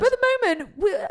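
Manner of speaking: high, loud, laughing through tears; real crying. Real, not acted. Exhausted host explaining herself to co-host, crying laughing. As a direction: wail it out half laughing. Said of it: "why I'm crying".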